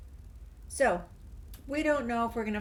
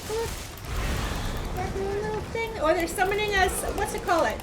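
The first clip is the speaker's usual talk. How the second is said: higher and louder, thrilled; excited; 10 semitones higher, 6 dB louder than usual. Real, not acted. Watching enemies summon, high excited chatter. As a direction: get worked up as they summon.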